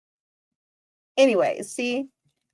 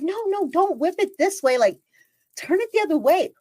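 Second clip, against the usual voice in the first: higher, exasperated pleading order; exasperated command; 9 semitones higher than usual. Retold or imitated. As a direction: urgently wave him off. Ordering stranger to turn away.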